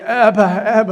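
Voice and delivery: loud, high ecstatic cry of Abba; shouting out. Retold or imitated. Man overcome in church shouting Abba aloud. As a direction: call it out with overflowing joy. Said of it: "And I'm shouting".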